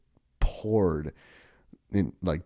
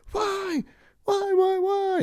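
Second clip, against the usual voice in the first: very high mock wailing of a TikToker; slower than usual. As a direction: caricature a distraught crier, pitched way up. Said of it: "like, possessed".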